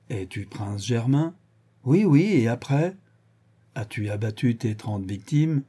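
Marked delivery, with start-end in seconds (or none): 1.8-3.0 high, testy double yes; impatient. Acted. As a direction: hurry the questioner along. Vorski exasperated by the questioning. (impatient, voix plus aiguë)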